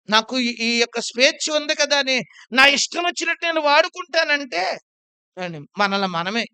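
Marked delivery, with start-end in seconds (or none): 0.1-4.8 loud preaching. Real, not acted. Preacher mocking selfish attitude.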